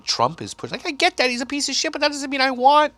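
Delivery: ordinary talk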